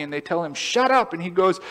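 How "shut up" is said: crowd snapping. Retold or imitated. Crowd silencing the blind man.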